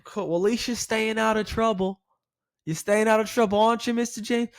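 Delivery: high teasing banter; playful. Shown as a demonstration. Agent joking with the caller, high and playful. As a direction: rib him gently. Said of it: "the playful tone".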